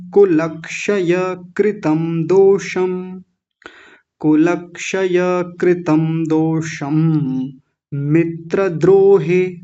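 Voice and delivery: कुलक्षय कृतं दोषं said slowly and distinctly; slow Sanskrit recitation. Shown as a demonstration. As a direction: recite slowly so learners follow. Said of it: "प्रवाह से".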